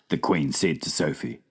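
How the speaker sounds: plain reading voice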